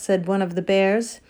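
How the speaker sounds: ordinary delivery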